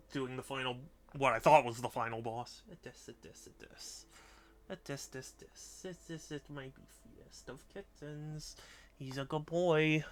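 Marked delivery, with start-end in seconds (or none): 2.7-8.6 softer, half-whispered cooing; hushed pet talk. Real, not acted. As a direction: murmur into the cat's fur. Hushed nuzzling of the cat.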